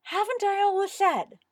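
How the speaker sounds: high, loud outburst; indignant demand. A narrator's reading. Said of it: "he demanded".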